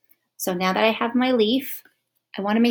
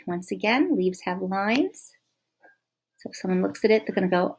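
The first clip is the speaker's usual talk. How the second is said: normal talking voice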